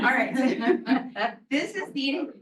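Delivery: high-pitched, through real laughter; trying to carry on while laughing. Real, not acted. Presenter resuming after a slide mishap, laughing.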